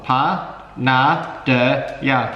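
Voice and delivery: one syllable at a time, separated; staccato. Shown as a demonstration. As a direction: spell it out syllable by syllable, each one detached. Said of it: "read it syllable by syllable".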